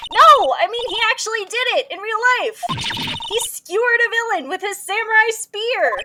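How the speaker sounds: high, loud, fast rush of words; urgent explaining. Acted. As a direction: hurry to convince him.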